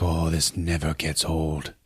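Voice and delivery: low, slow, soft savouring; quiet awe. Acted. Prince flying home into Wakanda, soft slow awe.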